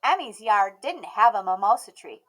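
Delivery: plain reading voice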